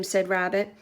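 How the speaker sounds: plain reading voice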